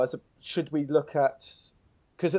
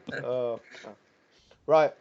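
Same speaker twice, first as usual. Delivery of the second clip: ordinary talk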